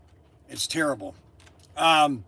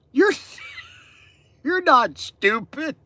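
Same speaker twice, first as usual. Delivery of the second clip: slow punchline broken by real laughter; can barely get the words out for laughing; giggling helplessly at the punchline; laughing through it; 5 semitones higher than usual. Real, not acted. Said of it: "Oh, I almost lost it".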